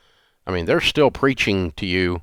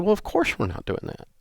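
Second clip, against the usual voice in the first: matter-of-fact; 10 semitones higher, 5 dB quieter than usual. Retold or imitated. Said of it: "And she said".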